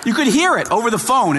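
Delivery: ordinary talk, nothing marked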